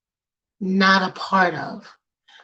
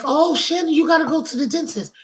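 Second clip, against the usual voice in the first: higher, fast playful ribbing; teasing friends; 7 semitones higher, faster than usual. Retold or imitated. Friends teasing Shannon about her teeth, fast and mocking. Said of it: "Everybody like".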